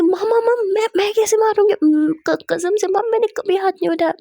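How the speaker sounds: high, stammering plea of innocence; panicked denial. Acted. Daughter being smacked by her mother, panicked high-pitched denial. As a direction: protest loudly, tripping over your words.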